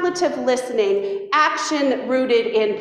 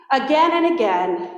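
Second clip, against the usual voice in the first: ordinary talk, nothing marked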